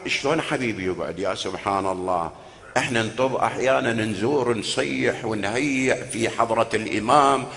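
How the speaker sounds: quiet aside. Real, not acted. Preacher turning aside to talk to the mourners. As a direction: drop the voice and confide.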